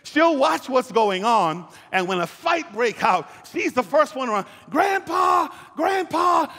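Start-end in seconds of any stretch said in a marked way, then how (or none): 4.7-6.5 put-on high, loud calling out of a little girl; tattling granddaughter. Granddaughter calling grandpa to report her brothers fighting, high tattling shout. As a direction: yell for grandpa like a kid telling on her brothers.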